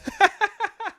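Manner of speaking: short rising giggle; high laugh. Real, not acted. Laughing at his confession about older people.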